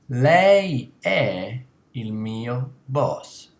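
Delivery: slow formal Italian. Shown as a demonstration. Teacher stressing the formal form "Lei è il mio boss". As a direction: give "Lei è il mio boss" slowly and clearly.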